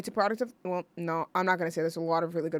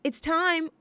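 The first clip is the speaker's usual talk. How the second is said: Alice's line; 8 semitones higher than usual. Retold or imitated. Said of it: "clip of this scene where Alice goes".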